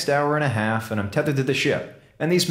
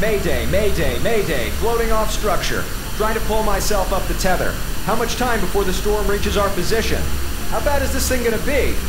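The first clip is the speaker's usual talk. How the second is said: higher, louder urgent calls; panicked mayday; 9 semitones higher, 3 dB louder than usual. Real, not acted. Eiffel adrift, calling the commander in panic. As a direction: call for help while drifting away.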